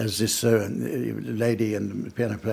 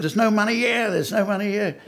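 higher, alarmed mock cry; panicky robber; 10 semitones higher than usual. Accomplice finding the till empty, higher mock panic. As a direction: squawk it like a flustered thief. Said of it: "And the panic reigned".

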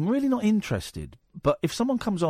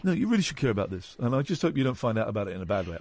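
mock sanctimonious; 7 semitones lower, faster than usual. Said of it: "I always hate when people say".

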